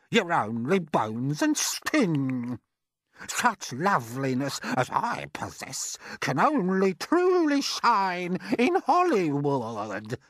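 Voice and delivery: high, slow, preening aunt's voice; drawn-out mocking call to her sister; vain aunt calling out. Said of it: "Aunt Sponge declared".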